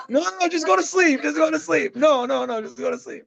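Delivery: mock-sweet hushing to sleep; fibbing parent. Dad mocking himself hushing the kid to sleep, high and sing-song. Voicing parent's soothing lie.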